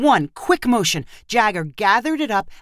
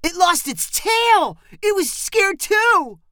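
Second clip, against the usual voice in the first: put-on high and slow, eager kid; excited shout; 12 semitones higher, slower than usual. A narrator's reading. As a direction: call it out, amazed by the lizard. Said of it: "one of Heather's brothers shouted".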